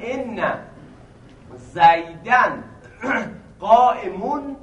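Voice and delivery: زیدن قائمون spelled out slowly, zaydan qa'imun; slow, deliberate zaydan qa'imun; careful recitation of زیدن قائمون; slow grammar demo. Shown as a demonstration.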